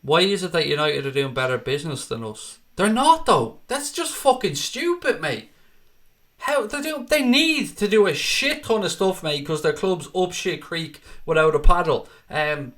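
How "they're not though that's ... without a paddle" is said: high, incredulous and scornful, slowed on the swearing; exasperated scoff. Real, not acted. Streamer scoffing at a viewer's question, exasperated and incredulous. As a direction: scoff at the idea like it's absurd.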